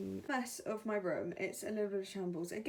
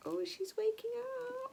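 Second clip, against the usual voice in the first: surprised. Real, not acted. Baby stirs.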